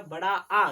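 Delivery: बड़ा आ said with a loud, full आ; loud long आ; forceful long aa. Shown as a demonstration. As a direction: put power on the आ. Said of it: "जोर से बोलने पे आएगा".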